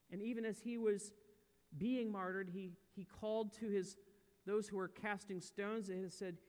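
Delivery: ordinary delivery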